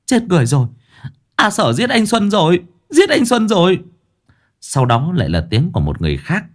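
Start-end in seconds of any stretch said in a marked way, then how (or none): 0.1-3.8 screaming. Acted. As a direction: cry out in horror. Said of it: "kêu lên".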